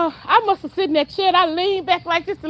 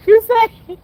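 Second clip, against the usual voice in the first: ordinary delivery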